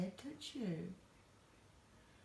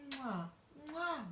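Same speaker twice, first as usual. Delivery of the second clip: kissing sounds; 3 semitones higher than usual. Real, not acted. Blowing kisses to parrot.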